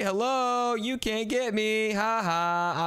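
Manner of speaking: high, drawn-out singsong taunt; teasing taunt. Real, not acted. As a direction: call out mockingly to the monster below. Taunts monster from a high spot.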